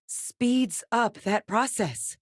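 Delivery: high, slow, weighted disclosure; alarmed revelation. Acted. Researcher explaining what speeds up Terramorph growth, alarmed. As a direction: spell out the discovery slowly in disbelief.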